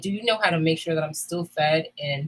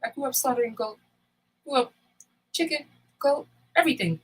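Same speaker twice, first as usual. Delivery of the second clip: lightly voiced husband, higher; a hint of the husband's voice, pitched up; quoting husband; 8 semitones higher than usual. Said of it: "He was like".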